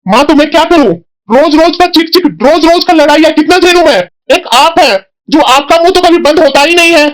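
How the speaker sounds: fed up, venting at his mother over the daily bickering; exasperated outburst. Acted. Son exasperated by constant fights.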